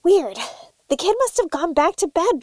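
ordinary talk, nothing marked

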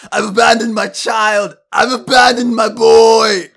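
hammed-up full-volume screamed confession; Daniel Plainview. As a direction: bellow it high, drawing out the last word. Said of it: "pulled my best Daniel Plain, Plainview".

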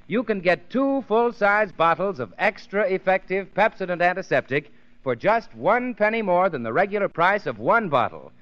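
ordinary talk

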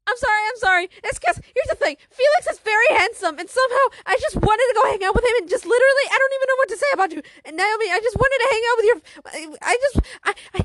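high, loud, rushed, stammering confession; acted panicked rambling. Anxious girl explaining her crush to friends, panicked babbling. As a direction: babble frantically, tripping over the words.

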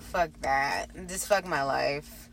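low, quiet and slow; deflated mutter. Retold or imitated. Giving up on getting his number, quiet slow mutter. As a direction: sag into defeat. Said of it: "And I was just like".

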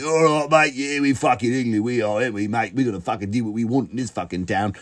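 lightly voiced English lad, cocky; English lad accent. Comedian mocking an English tourist in Ibiza, cocky English accent. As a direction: swagger through it like a rowdy Brit abroad. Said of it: "like an English person".

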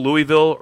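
Louisville said LOO-ee-vill; quiz pronunciation option. Shown as a demonstration. Host repeats the choices, Louisville as LOO-ee-vill. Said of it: "How do you pronounce".

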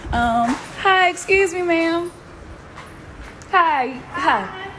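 nervous, high and giggly; jittery voice when approaching a stranger; stage fright. Real, not acted. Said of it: "I'm a little nervous".

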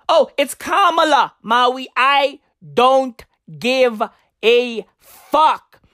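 normal talking voice

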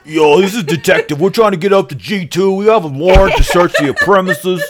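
loud, high, laughing bad New York cop accent; terrible New York accent. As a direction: ham up a fake New York detective, laughing through it. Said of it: "terrible New York accent".

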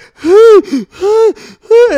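a huge, high, loud theatrical gasp; mock dramatic gasp. Mocking the bitten man's overblown reaction, a huge gasp. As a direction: gasp like you've been mortally wounded.

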